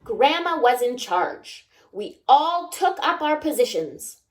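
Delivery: plain reading voice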